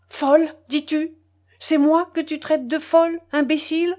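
high furious outcry; screaming. A narrator's reading. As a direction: hurl the insult back at him. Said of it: "vociféra Catherine Ivanovna".

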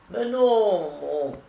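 high-pitched protest, cut off. A narrator's reading. Boxer objects to being thrown out, protesting.

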